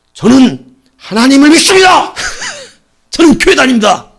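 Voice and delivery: firm soldier's proclamation of faith; defiant shout. Retold or imitated. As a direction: bark it out like reporting to an officer. Soldier's defiant declaration to senior.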